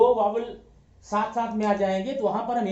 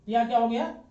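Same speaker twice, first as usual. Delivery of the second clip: usual speaking voice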